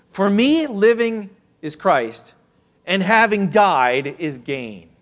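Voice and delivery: loud and high, slow, every word driven home; emphatic proclamation. Acted. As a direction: preach it out full force, slowly. Preacher proclaims the key verse.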